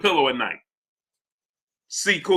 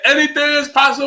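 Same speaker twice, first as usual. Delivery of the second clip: much higher, yelled celebration; Kevin Garnett impression; 9 semitones higher, 3 dB louder than usual. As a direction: belt it out like a champion who just won it all. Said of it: "what Kevin Garnett said".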